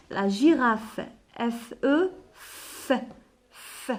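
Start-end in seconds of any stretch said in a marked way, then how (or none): 2.3-3.9 "f" as a bare voiceless sound; soft isolated "f", as at a word's end; final f sound. Shown as a demonstration. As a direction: say "f" as the consonant alone, not the letter name. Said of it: "Parce que là, la fin".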